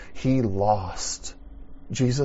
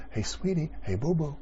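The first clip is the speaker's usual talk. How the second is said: affectionate call; 6 semitones higher than usual. Retold or imitated. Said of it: "term of endearment".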